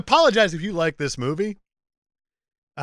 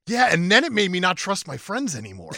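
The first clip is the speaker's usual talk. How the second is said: usual speaking voice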